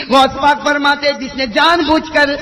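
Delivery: high, loud stern sermon; preaching at full force. Acted. Preacher warning listeners who miss prayers, stern loud. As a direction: hammer out the fatwa to the crowd. Preaching Ghaus Pak's fatwas.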